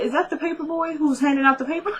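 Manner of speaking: high, rapid incredulous question; amused disbelief. Retold or imitated. Viewer reacting to the paper boy scene, amused disbelief. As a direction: ask it fast and high, about to crack up.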